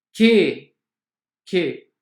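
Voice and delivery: che said as "ke", hard k. Shown as a demonstration. Teacher to students showing che pronounced ke. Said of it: "C H com som de K".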